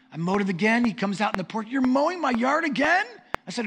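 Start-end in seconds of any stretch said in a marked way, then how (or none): 1.7-3.1 high, loud incredulous question; mock disbelief. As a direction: imitate a neighbor calling out in disbelief. Neighbor comes out, finds mowing again.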